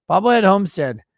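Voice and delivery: loud, high name call-out; excited shout-out. Real, not acted. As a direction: shout the name across the room.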